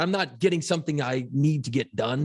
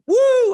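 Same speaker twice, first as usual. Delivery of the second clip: high, loud "woo!"; excited whoop; 12 dB louder than usual. Retold or imitated. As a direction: whoop like an eager first-day student. Acts out his eager hand-raise.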